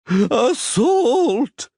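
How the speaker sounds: high, far-off official's yell; mock outraged yell. A narrator's reading. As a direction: cry out from the floor. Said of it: "yelled the skinny individual".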